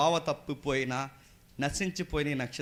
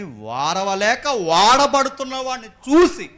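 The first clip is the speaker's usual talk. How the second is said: slow, drawn-out, loud and high; emphatic preaching; 9 semitones higher, 14 dB louder, slower than usual. Acted. Preacher explaining Cain's envy of his brother, emphatic preaching. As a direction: stretch the words out forcefully for the congregation.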